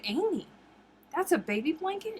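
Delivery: high disbelieving question; can't believe the size of it; incredulous question. Retold or imitated. Retells doubting cousin's blanket.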